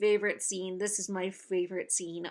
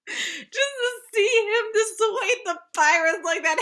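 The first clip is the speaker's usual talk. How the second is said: high, loud, helpless real laughter through the words; laughing while talking; 11 semitones higher, 7 dB louder than usual. Real, not acted. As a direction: squeal it out while cracking up. Cracking up at the scene.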